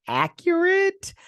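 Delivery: very high, drawn-out sarcastic accurate; mock high stretch. Real, not acted.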